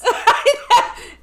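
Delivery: high loud laugh. Real, not acted. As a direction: burst out laughing. Laughing at scare actors.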